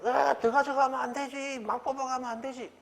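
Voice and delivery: very high, squawking mock objection; protesting market vendor. Bean sprout seller stopping a grabby customer, high-pitched protest.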